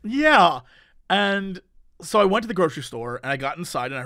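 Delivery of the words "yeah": ordinary talk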